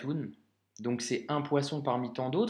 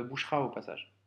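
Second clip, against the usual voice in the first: usual speaking voice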